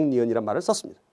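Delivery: ordinary delivery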